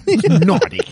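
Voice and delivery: giggling mock-naughty drawl; laughing naughty. Host teasing mapmaker friend, laughing naughty. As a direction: laugh the word out as a tease.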